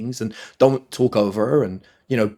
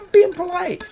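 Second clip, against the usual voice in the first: mock polite; 3 dB louder than usual. Mock reply to friends in car.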